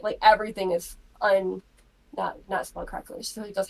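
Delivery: ordinary delivery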